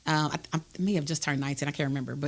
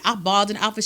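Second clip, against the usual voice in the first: usual speaking voice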